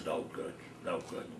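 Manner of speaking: put-on low, soft growl from a cranky old man; lightly voiced cranky old father, low and soft. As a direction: grumble it down low and dismissive. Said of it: "he had a growling voice like this".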